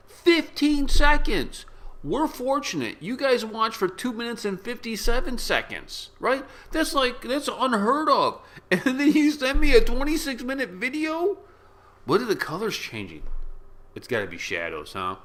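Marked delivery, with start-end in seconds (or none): 0.1-1.7 high, loud, drawn-out disbelief; incredulous exclamation. Real, not acted. Vlogger to viewers about how briefly they watch, incredulous exclamation. 2.0-11.4 high-pitched, animated disbelief; incredulous rant. Real, not acted. Vlogger to viewers who send long videos, incredulous rant.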